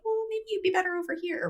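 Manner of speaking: a gentle high sugar-coated hint; polite brush-off. Retold or imitated. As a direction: ease them out nicely, voice lifted high. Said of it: "you're gonna be like".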